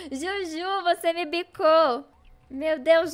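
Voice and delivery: high, loud, playful complaint; indignant. Real, not acted. Streamer tells off hen Juju for pecking, loudly indignant. Scolds the hen that pecked her.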